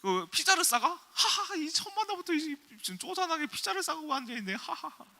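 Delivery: very high, hammed-up scornful laughing; incredulous mocking laughter. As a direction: imitate a date laughing in disbelief. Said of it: "그렇게, 그래서 웃었대요". (기가 막혀 높게 웃어대는 과장된 흉내)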